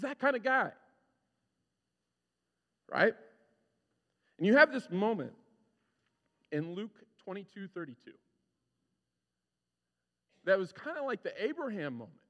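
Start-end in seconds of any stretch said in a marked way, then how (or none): none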